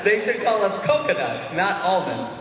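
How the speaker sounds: ordinary delivery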